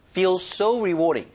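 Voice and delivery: "so" punched and drawn out; stress on SO. Shown as a demonstration. Teacher models the phrase for learners, stress on "so". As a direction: hit "so" hard, then fall through "rewarding". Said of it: "so에 강세를 주셔도 되고".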